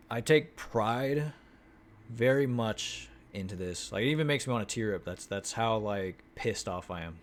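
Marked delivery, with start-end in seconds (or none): none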